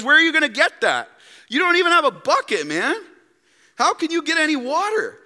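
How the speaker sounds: high, fast, scoffing challenge; mock her disbelief, quick and loud; incredulous woman at the well. Samaritan woman scoffing at the stranger without a bucket. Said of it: "She goes".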